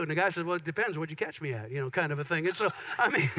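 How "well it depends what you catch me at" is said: fast, soft, low joking reply; sheepish joke. Retold or imitated. The husband dodging his wife's question, quick and sheepish.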